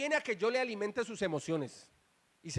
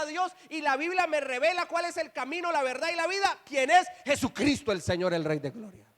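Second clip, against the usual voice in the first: voz de púlpito aguda, en oleada continua; predicación fervorosa; 4 semitones higher than usual. Real, not acted. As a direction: encadena las afirmaciones con fervor creciente y tono alto. (prédica encendida, sin soltar el impulso)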